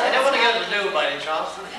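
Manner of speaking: ordinary talk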